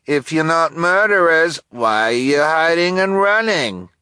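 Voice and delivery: high, loud slow sneer; mock them with a sneer; delivery man sneering at the hidden children. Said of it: "said in a sneering voice".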